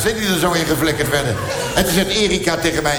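usual speaking voice

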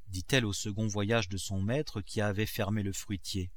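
ordinary delivery

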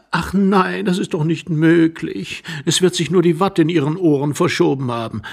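put-on high-pitched young wife brushing it off; dismissive young wife. Young wife blames cotton wool in his ears, dismissive. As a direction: talk him out of it, high voice.

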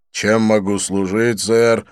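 slow, polite waiter's offer; a lightly voiced over-polite waiter; courteous waiter. Waiter Sweetie politely asks Didier, slow courteous. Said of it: "учтиво осведомился Свити".

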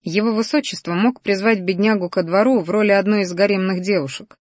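usual speaking voice

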